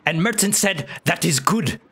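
high broken-English goblin; squeaky little goblin merchant. Acted. Goblin voice continues.

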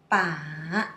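ba dipping low, then rising; third tone. Shown as a demonstration. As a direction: stretch the low dip in ba. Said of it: "Let's see more example".

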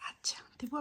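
ordinary delivery

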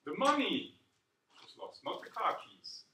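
"money" loud and swooping high, the rest dropping back; "The money" punched out first; stress on MONEY. Shown as a demonstration. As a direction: lean hard on "money" to contrast it. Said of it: "for the passive voice, the money".

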